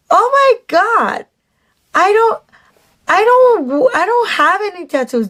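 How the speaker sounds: high, stammering shocked protest; shocked denial. Retold or imitated. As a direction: gasp it out, stunned and defensive. Indignant retort retold to date.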